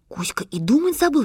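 plain reading voice

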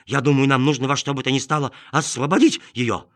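excited little dog voice; cartoon dog. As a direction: bark it out, lightly. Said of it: "пролаяла она".

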